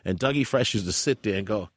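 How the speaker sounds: ordinary delivery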